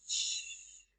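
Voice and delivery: soft shushing of waves; the narrator echoing the sea's hush, soft; whispered sea hush. A narrator's reading. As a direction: breathe out a wave's hush. Sound of the sea.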